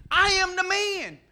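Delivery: the healed blind man, high, loud and overjoyed; a healed man insisting he can see, laughing; joyful insistence. Acted. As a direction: proclaim it with a laugh of joy. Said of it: "the blind man with his eyes open saying".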